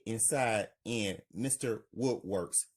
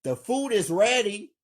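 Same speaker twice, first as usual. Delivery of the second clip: higher, loud call across the house; yelling; 9 semitones higher, 6 dB louder than usual. Acted. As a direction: call everyone to dinner loudly. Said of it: "Scott Junior yells".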